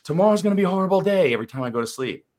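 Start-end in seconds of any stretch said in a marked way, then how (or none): 0.0-1.3 much higher, fast, loud dire warning; mock dread. Coach voicing the subconscious mind's dread before sleep, high and fast. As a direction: rattle it off like a doom prophet. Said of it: "it's also gonna go".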